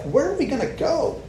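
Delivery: a disciple's high, bewildered plea; incredulous Peter. Retold or imitated. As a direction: ask it like there's nowhere else to go. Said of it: "He's like".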